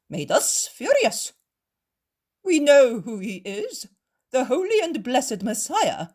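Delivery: fawning. A narrator's reading. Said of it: "change tone and become like creeping pups".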